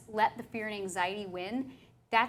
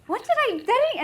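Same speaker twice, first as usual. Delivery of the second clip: high mock confusion; 13 semitones higher, 7 dB louder, faster than usual. Retold confused self.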